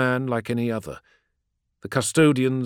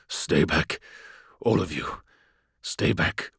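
low, strained, whispery; raw telepathic voice; 8 semitones lower, 5 dB quieter than usual. A narrator's reading. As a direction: force it out through pain. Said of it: "His telepathic voice was raw".

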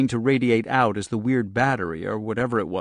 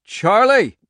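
urgent cracked call; 11 semitones higher, 8 dB louder than usual. Acted. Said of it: "His voice cracked and urgent".

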